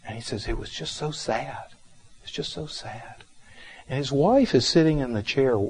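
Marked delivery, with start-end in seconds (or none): 0.5-1.6 softly weeping. Retold or imitated. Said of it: "he's just softly weeping". 2.2-3.2 softly weeping. Retold or imitated. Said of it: "he's just softly weeping".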